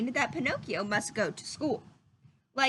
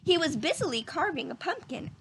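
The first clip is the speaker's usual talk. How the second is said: plain reading voice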